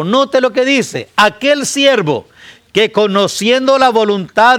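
high, loud warning; full-force preaching. Acted. Preacher warning his congregation, high and loud. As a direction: thunder the warning to the church.

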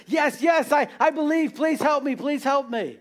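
acted high-pitched plea from a cliff edge; urgent mock begging, easing at the end; desperate pleading. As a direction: play a man begging to be rescued. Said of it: "man's hanging there by the cliff".

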